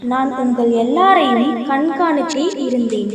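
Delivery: put-on a gentle heavenly being blessing the children; the whale reveals itself as an angel, low, soft and solemn. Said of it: "நான் ஒரு தேவதை".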